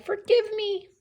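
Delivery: earnest, high-pitched plea; pleading mouse. Acted. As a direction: plead like a small mouse, voice lifted, then easing down. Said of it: "But the mouse said".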